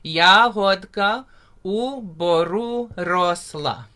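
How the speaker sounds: slow, carefully spoken "Yahodka u boru rosla" with rolled r; teacher modelling a Ukrainian line; slow Ukrainian demo. Shown as a demonstration. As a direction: spell out "Yahodka u boru rosla" slowly for learners. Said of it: "So everyone say with me".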